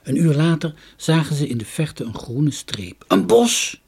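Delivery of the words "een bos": high, loud cry of discovery; raised storybook shout; excited call. A narrator's reading. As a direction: call out what you have spotted. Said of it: "riep Jorkos".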